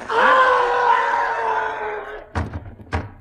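a loud sharp gasp of fright; a startled wife gasping at the theft, loud and sharp; shocked gasp. Acted. Wife sees the bust is gone.

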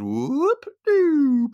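very high, drawn-out comic whoop-a-doop; mock pop-up noise. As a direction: stretch it slowly like a cartoon spring. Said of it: "all of a sudden it goes".

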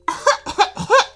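high, loud put-on cough; faked cough. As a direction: fake a cough to get attention. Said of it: "faked a cough".

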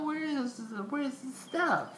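high-pitched imitation of a confused girlfriend. Man mimicking his girlfriend, high and puzzled. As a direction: play her confused and clueless, pitch way up. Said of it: "she was like".

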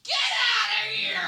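high, drawn-out hammed-up yell; imitating an outraged bystander yelling; hostile shout. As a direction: shout them away in mock outrage. Acting out hostile outburst.

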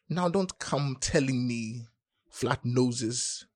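ordinary delivery, nothing marked

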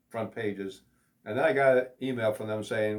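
ordinary talk, nothing marked

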